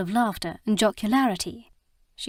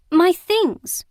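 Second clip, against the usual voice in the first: much higher, louder desperate cry; 10 semitones higher, 8 dB louder than usual. Acted. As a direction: shout it as you're dragged away. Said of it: "she cried".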